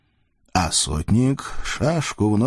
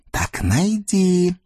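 witch; 8 semitones higher, much slower than usual. Acted. Witch presses the voivode.

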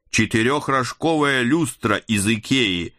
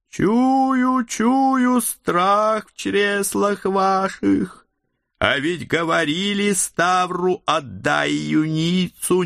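eerie sorcerer voice; 11 semitones higher, slower than usual. A narrator's reading. Hooded figure threatens, archaic speech.

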